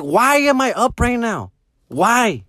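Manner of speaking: high, drawn-out "why" of protest; exasperated complaint. Retold or imitated. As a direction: whine it in disbelief at being up. Acted complaint about being up early.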